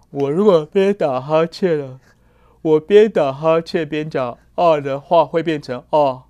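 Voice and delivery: words stretched through a yawn; yawning while talking. Shown as a demonstration. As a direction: talk as if mid-yawn.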